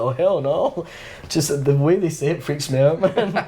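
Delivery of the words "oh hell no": higher, flat-out mock refusal. Retelling his refusal of garlic mayo, mock disgust. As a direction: recoil in playful disgust. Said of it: "I'm always like".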